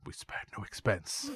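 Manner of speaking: drawn-out boast of a kindly showman; Attenborough impression. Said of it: "famous actor they've got to do the voice of".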